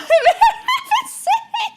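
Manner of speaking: a high, squeaky burst of laughter; high squealing laugh. Real, not acted. Laughs at own building.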